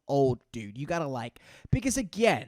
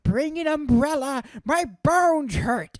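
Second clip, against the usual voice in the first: put-on high, loud, drawn-out old-timer nagging; old person; 10 semitones higher, 6 dB louder, slower than usual. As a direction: wail it like a hammed-up elderly person. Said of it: "frail bones of an old person".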